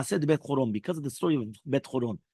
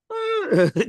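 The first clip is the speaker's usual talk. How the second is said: incredulous laugh; 4 dB louder than usual. Acted. Friend scoffs at the scheme.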